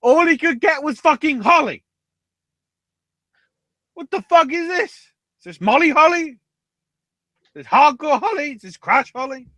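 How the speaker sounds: high-pitched indignant rant; hammed-up squawk of indignation; mock outrage. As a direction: rail in disbelief. Mock outrage at holly instead of mistletoe.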